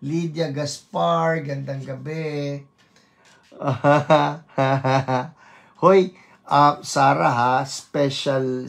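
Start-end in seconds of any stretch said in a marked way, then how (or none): 3.6-5.3 two short bursts of laughter; real chuckle. Real, not acted. Host amused between greetings to viewers, real chuckle. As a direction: chuckle twice at your own pitch.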